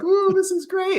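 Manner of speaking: very high, silly happy voice; giddy cheer. Retold or imitated. Runner delighted during brutal hill workout, giddy high voice. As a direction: squeak it out blissfully. Himself smiling while puking.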